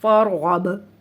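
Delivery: फरगब loud, final b bounced; qalqalah bounce. Shown as a demonstration. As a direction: recite फरगब, releasing the last b with an echo. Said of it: "मखरज को हिलाकर ख़त्म करेंगे". (फरगब, बा पर कलकला के साथ)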